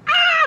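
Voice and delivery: a loud, high excited scream; loud high fangirl scream on cue; retold fan scream. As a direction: scream like the singer asked you to. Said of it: "Scream as loud as you can".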